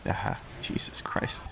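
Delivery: under-the-breath exasperated curse; muttered curse. Real, not acted. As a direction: mutter it almost voiceless, fed up. Frustrated gamer muttering at wipe.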